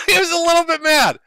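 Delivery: high and loud through laughter; said while still laughing. Real, not acted. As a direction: repeat it cracking up. Laughing repeat of understatement.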